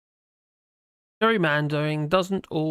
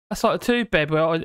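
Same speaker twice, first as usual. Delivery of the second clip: ordinary talk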